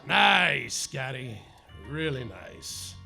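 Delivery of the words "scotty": ordinary delivery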